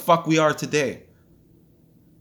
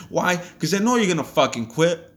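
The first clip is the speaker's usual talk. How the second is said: normal talking voice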